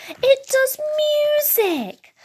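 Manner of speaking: high, drawn-out cry of delight; amazed child. A narrator's reading. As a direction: exclaim slowly in a thrilled little voice. Discovering the music button.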